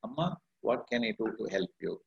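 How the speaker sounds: soft, calm and gentle question; peaceful. Pretend. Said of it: "but this time I'm peaceful".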